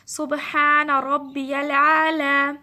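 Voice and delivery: سبحان ربی الاعلی chanted very slowly and loudly, each syllable held; slow recited tasbih. Shown as a demonstration. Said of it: "سجدے کی تسبیح ہے".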